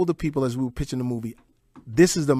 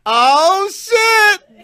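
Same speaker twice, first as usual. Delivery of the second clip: high, loud shout across the street; starstruck shout; 10 dB louder than usual. Retold or imitated. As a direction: yell it at the star. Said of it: "I'm the oh shit dude".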